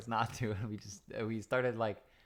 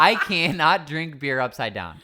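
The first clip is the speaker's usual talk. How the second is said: louder, laughing through the words; half-laugh while admitting it; 5 semitones higher, 10 dB louder than usual. Real, not acted. Laughing confession about keg stand.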